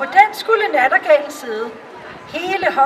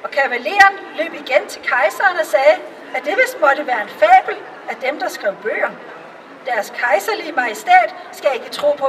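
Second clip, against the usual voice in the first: plain reading voice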